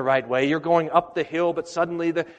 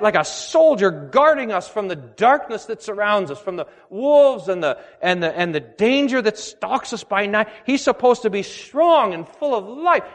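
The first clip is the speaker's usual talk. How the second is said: raised, emphatic pulpit voice; impassioned preaching; 5 semitones higher, 3 dB louder than usual. Acted. Pastor preaching to the congregation about the good shepherd, impassioned. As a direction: preach it with conviction.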